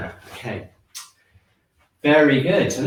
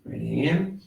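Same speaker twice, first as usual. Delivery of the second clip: guided breathing cues; 2 semitones lower, 6 dB quieter than usual. Acted. Teacher guiding a qigong class through breathing, calm cues. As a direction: talk the class through each breath, unhurried.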